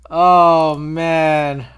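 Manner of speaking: loud, drawn-out regretful groaning; slow, loud lament after choking; loud dismay. Real, not acted. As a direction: groan your apology out loud.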